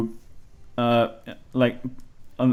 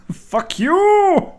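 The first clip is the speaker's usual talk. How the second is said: high, hammed-up rage yell; mock outraged shout. Gamer cursing the co-op partner, mock outrage. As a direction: play the outraged gamer, pitched way up. Acted anger at a co-op player.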